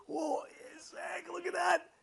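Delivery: high-pitched, drawn-out mock wow; hammed-up awe over a treat; acted amazement. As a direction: marvel at it in a high put-on voice. Acting out delight at dessert.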